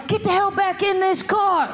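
mock-angry parent calling out; yelling parent. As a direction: holler it at a kid out the car window. Voices parent yelling from car window.

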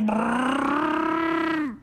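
high trilled engine roar; revving car noise. Acted. Ant accelerates the Mercedes, revving noise. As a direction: trill a rising engine rev.

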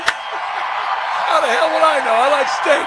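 high, laughing repeat of a punchline; delighted mimicry. Host echoes the President's punchline, high and laughing. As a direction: crow the line through laughter.

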